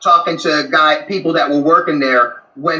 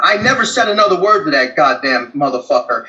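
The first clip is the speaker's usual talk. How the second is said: ordinary delivery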